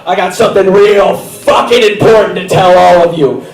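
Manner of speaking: loud, high conspiracy rant; manic teacher. Pretend. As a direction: yell at the kids like an unhinged teacher. Crowd work as manic teacher.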